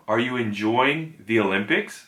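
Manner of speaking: usual speaking voice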